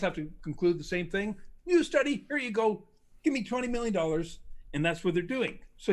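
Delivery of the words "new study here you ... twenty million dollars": mock greedy researcher. Mocking researchers asking for money.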